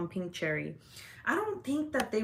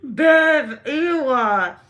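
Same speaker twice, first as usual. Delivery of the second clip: loud disgusted ew; 7 semitones higher, 12 dB louder than usual. Real, not acted. Disgusted reaction to friend.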